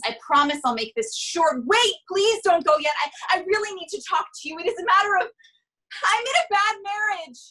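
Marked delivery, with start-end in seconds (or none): 1.7-2.9 high, loud, begging them to stay; desperate plea. Acted. Saleswoman begs the leaving neighbor, loud and desperate. 3.0-7.4 flustered blurting. Acted. Blurting confession to stop neighbor.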